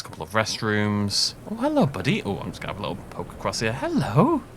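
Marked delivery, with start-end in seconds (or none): 1.5-2.2 high-pitched "hello, buddy" to a little pal; playful greeting. Pretend. Playful greeting to someone in game. 3.7-4.4 high, sing-out greeting with rising end; playful hello. Pretend. Calls playfully while poking around.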